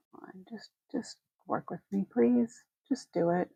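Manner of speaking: quiet pleading under the breath; pleading with a machine. Real, not acted. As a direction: coax the computer softly, half begging. Coaxing a stuck computer under breath.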